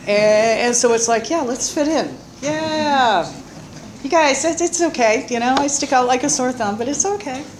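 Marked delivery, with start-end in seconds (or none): 1.3-7.4 mock cheerful. Mock-cheerful kid trying to fit in.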